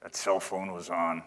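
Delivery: low grumbling mutter under the breath. Retold or imitated. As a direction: grumble it low and soft to yourself. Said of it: "you mutter stuff under your breath".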